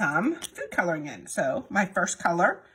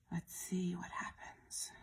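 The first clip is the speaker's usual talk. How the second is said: hushed whisper; 11 dB quieter, slower than usual. Real, not acted. Watching colors mix.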